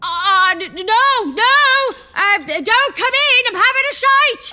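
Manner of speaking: loud, high panicked yell; panicked shouting. Acted. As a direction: shout to keep him out.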